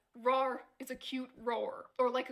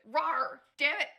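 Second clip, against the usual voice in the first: louder, higher fake outburst; mock frustration; 4 semitones higher, 4 dB louder than usual. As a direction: fume in a jokey way.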